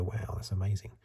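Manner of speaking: ordinary delivery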